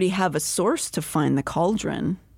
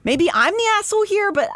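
rising, fast, fed-up scolding; exasperated rant; 6 semitones higher, 3 dB louder, faster than usual. Acted. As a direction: vent at her like an exasperated sister. Exasperated rant at sulking Elaine.

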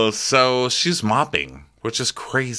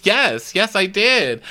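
high, exasperated mock protest; imitating an indignant worker; defensive insistence; 11 semitones higher, 3 dB louder, slower than usual. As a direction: insist shrilly when questioned. Defensive answer to captain's questioning.

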